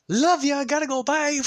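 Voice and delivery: bolting out of the house; hasty farewell. Acted. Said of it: "It's like, uh".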